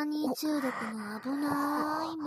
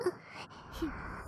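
breathy teasing giggle; 4 semitones higher, 6 dB quieter than usual. Acted. Teasing laugh at listener.

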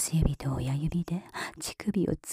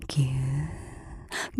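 soft squeeze sound; 5 semitones lower, 5 dB quieter, much slower than usual. Acted. Squeeze sound, soft then loud.

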